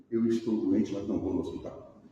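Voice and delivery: low, subdued voice of a sick person; mock patient's excuse.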